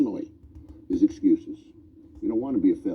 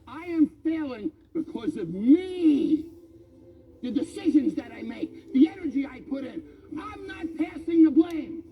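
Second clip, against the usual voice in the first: self-confession building to shout; 8 semitones higher than usual. Retold or imitated. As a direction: start measured, end shouting the admission. Self-talk confession in mirror.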